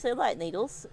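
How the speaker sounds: usual speaking voice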